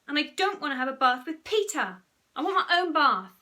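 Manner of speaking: loud, high, whiny grumble; whining refusal; moaning protest.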